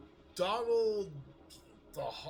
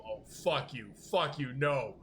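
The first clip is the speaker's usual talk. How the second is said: mock outrage; 4 semitones higher, 8 dB louder, slower than usual. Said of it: "both came to the realization".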